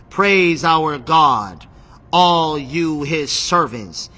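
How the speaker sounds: slow, drawn-out scripture proclamation; proclaiming. Acted. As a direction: stretch each word like a solemn command. Reads voice from the throne.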